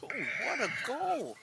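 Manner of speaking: high, loud goal cheer; excited exclamation. Real, not acted. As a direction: marvel at the goal. Commentating friend's goal.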